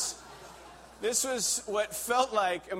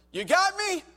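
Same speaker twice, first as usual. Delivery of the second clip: hammed-up terrified, much higher and louder; mock panicked squeak; 8 dB louder than usual. Climber frozen on the ice begging Kevin, mock panic. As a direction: squeal it like a scared kid clinging on. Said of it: "And I was like".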